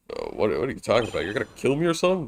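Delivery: nervous rambling. Acted. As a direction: rush it out in a panic. Larry panics at the threat.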